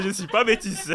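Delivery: high, loud laughing words; giggling denial. Real, not acted. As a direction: say it while still cracking up. Laughing joke continues.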